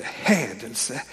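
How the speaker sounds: low muttered hädelse with a rise; scandalised priest. Retold or imitated. Priests reacting to Jesus' words, low scandalised mutter. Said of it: "som de skriftlärda och prästerna tänkte".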